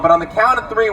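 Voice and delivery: normal talking voice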